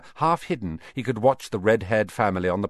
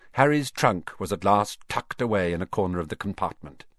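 usual speaking voice

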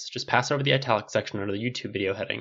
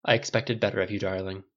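plain reading voice

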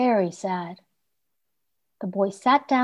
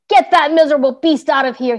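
louder angry trader; shouting; 5 semitones higher, 7 dB louder, faster than usual. Acted. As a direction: bark him away. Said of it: "he shouted to the boy".

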